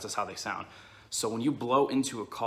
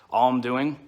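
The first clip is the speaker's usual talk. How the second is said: vocal cord hum, up close; humming into call; 3 semitones higher, 10 dB louder than usual. Shown as a demonstration. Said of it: "So now up close".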